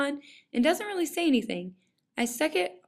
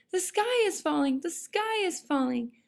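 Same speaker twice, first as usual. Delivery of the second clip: higher-pitched hen in mock alarm; clucking hen; 6 semitones higher than usual. A narrator's reading. Chicken Licken cuts off Jack's warning, clucking in alarm. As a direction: interrupt as a lightly voiced, panicky hen. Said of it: "clucked Chicken Licken".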